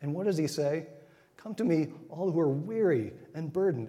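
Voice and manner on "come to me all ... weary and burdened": preached quote. Acted. Quotes Jesus with raised voice.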